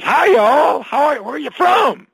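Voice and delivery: put-on high, loud Texan accent for a Texan joke; Texan greeting. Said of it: "a Texan bumps into".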